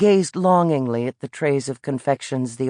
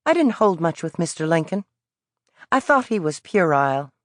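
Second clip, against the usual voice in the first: higher, cagey Southern voice; evasive Southern boy; 3 semitones higher than usual. Acted. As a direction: tease without giving anything away. Boy speaks.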